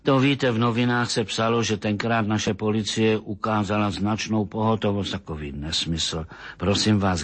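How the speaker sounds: usual speaking voice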